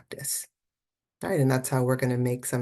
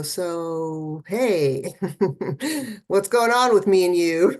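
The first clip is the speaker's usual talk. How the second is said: casual greeting; 2 semitones higher, 5 dB louder, slower than usual. Pretend. Casually greeting her inner critic.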